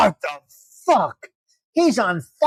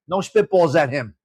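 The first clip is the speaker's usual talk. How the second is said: ordinary talk, nothing marked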